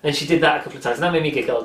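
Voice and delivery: ordinary talk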